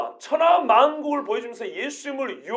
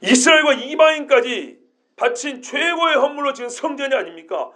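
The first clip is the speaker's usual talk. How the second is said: ordinary talk, nothing marked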